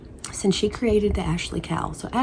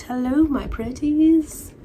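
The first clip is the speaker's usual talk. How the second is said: high, slow witchy cackle-voice greeting; witch voice; 6 semitones higher, much slower than usual. Pretend. A witch greeting her viewers for spooky season, high and slow. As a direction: drawl it out like a wicked witch.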